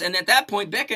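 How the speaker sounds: ordinary talk, nothing marked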